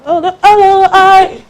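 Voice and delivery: high, affected hello voice; mock someone faking how they speak; put-on fake accent. Mocking people who fake it online, put-on high voice. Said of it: "the next thing you hear Igbo accent".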